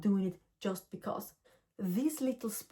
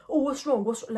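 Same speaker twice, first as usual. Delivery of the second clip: louder, higher retort, imitating their irritation; mock annoyed snap; 4 semitones higher, 6 dB louder than usual. Strangers in the street snapping at a driver, mock annoyed. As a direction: throw back an irritated what's wrong. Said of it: "they got mad at me".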